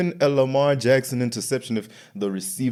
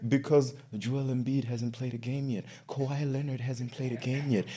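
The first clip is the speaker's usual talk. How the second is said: much softer aside within a rant; suddenly hushed; 3 semitones higher, 9 dB quieter than usual. Real, not acted. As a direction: drop your volume for the grievance. Rant drops suddenly to grumbling.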